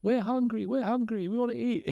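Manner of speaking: high-pitched mock cheer chant; cheerleaders. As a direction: imitate a squad clamouring for food. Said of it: "a whole bus of cheerleaders".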